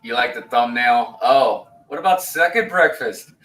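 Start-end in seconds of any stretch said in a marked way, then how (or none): 1.2-3.3 quoting the meme. Retold or imitated. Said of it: "that meme".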